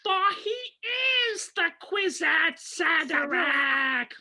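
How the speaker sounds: very high, slow, drawn-out proclamation; movie-line imitation. Host quoting the last line of the movie, high theatrical proclamation. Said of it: "the last line of the movie is".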